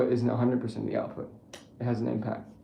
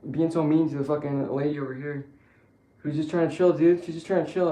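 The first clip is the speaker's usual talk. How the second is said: ordinary talk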